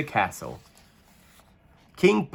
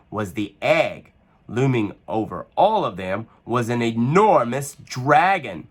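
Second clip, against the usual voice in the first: plain reading voice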